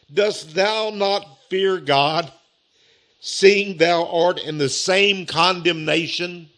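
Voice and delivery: slow, measured scripture reading; weighty, drawn-out verse reading. Real, not acted. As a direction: read it out deliberately, word by word. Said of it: "read this slow".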